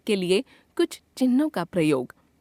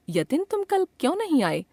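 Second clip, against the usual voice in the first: higher-pitched, questioning read; 7 semitones higher than usual. Acted. Teacher asks Yatin why he was absent yesterday, questioning. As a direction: ask it as dialogue, pitched up.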